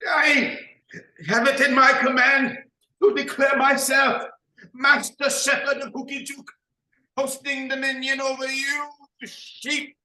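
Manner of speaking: high, loud proclamation; grand declaration. Acted. Nabalac proclaiming dominion over the imaginary sheep, booming. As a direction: proclaim yourself lord over the flock.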